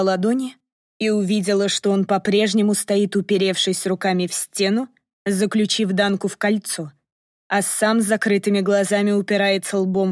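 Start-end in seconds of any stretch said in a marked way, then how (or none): none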